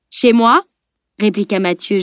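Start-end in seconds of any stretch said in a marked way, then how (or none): 0.1-0.6 raised, questioning tease; gently ironic. A narrator's reading. Mathieu replies to the shocked analyst, gently ironic. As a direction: answer with light, friendly irony. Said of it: "gentiment ironique".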